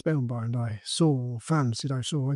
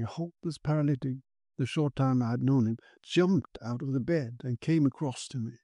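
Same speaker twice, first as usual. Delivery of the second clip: usual speaking voice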